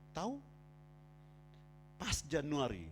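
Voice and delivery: normal talking voice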